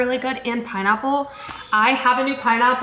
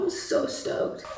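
slow, softer near-whisper; whispered excitement; 5 semitones higher, 8 dB quieter, slower than usual. Real, not acted. Vlogger sharing her excitement with viewers, whispered. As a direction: hush it like a secret thrill.

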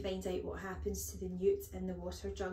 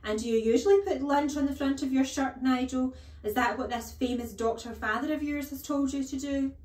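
loud, high, incredulous mockery; outraged, high-pitched sarcasm at full volume; sarcastic headmistress; 6 semitones higher, 10 dB louder than usual. A narrator's reading. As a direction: jeer the questions at the boy. Headmistress mocks Nigel.

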